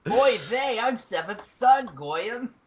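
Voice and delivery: very high, exaggerated New York accent; mock New York accent. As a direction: caricature a New York accent far above your own pitch. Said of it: "New York, uh, accent".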